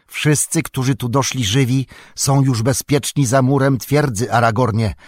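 ordinary delivery

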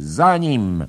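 loud, high "after him!"; shouting. A narrator's reading. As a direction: yell the order to chase. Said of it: "Bella krzyczy".

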